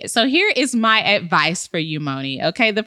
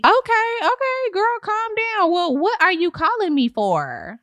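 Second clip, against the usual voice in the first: high, sassy scolding; sassy realtor; 10 semitones higher than usual. Acted. Realtor siding with the tenant, high and sassy. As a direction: scold her like a smug realtor.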